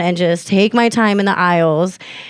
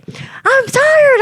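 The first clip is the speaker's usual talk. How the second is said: much higher, exasperated retold yell; mad retort. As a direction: snap back in a high, angry voice. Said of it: "mad, and I was like".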